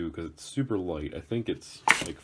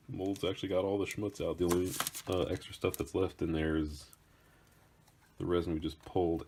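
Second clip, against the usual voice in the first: normal talking voice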